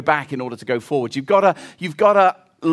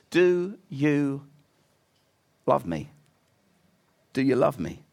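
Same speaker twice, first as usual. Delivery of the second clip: tender question; 4 semitones lower, 4 dB quieter than usual. Acted. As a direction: ask it gently, almost a whisper. Jesus asks tenderly.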